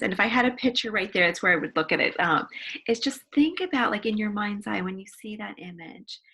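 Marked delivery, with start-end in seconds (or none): none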